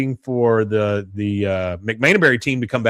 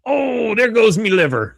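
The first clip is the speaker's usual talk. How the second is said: high, put-on Irish leprechaun wail; drunk Irish brogue; 10 semitones higher than usual. Drunk leprechaun falling over, hammed-up Irish brogue. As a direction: groan it out in a hammed-up Irish accent. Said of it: "Bobby says".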